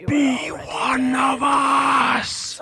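slow, drawn-out menacing whisper; sinister fly chorus. Retold or imitated. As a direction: hiss it slowly like a creepy swarm. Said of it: "De bara så här". (kuslig, väsande flugröst)

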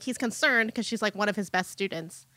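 ordinary talk, nothing marked

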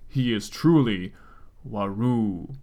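put-on solemn Japanese accent. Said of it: "in a Japanese accent".

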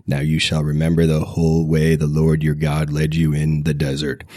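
usual speaking voice

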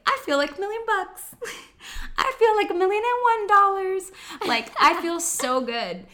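high, loud, over-the-top cheerful; forced happy tone. Retold or imitated. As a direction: fake joy to talk yourself up. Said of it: "force myself to say it in a happy tone".

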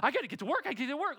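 frantic worker. Retold or imitated. Mocking anxious hurried worker.

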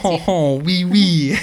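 mock French. Joking that French sounds fancy.

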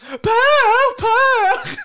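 shrieked, very high mocking mimicry; imitated high voice. Timon mimicking his friend's high voice, mock shrieking. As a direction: screech the name twice, mockingly. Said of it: "härmar han din ljusa röst".

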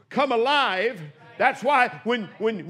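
ordinary delivery

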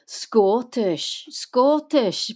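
drawn-out, swooping Scottish accent. Shown as a demonstration. Narrator priming Scottish before Sylvester's line, Scottish accent. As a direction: exaggerate a Scottish accent on one word. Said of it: "and then I'd go".

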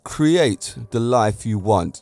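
ordinary talk

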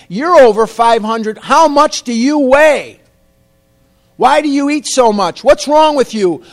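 loud, high-pitched berating rant; hollering dentist. Retold or imitated. Dentist berating patient about his weight, hollering. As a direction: imitate a furious dentist hollering at full tilt. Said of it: "He's hollering at me".

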